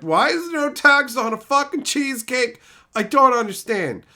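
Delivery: high, loud indignant outburst; loud incredulous complaint; exasperated rant. Retold or imitated. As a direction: throw it out like a fed-up shopper. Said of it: "And I was like".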